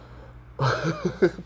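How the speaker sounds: short breathy chuckle; quick laugh at yourself; amused laugh. Real, not acted. Speaker catching their own mix-up, amused chuckle.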